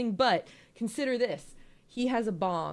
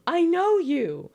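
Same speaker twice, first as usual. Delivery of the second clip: shocked recognition; 10 semitones higher, 9 dB louder, slower than usual. Retold or imitated. Hypnos recognizing Zagreus, shocked.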